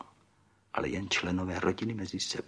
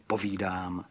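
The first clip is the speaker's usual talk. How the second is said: plain reading voice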